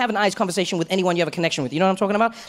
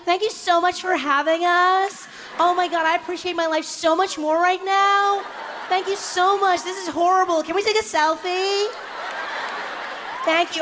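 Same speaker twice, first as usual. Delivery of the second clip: much higher, breathless gushing tourists; 10 semitones higher, slower than usual. Retold or imitated. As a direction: coo like clueless tourists. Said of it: "people were like".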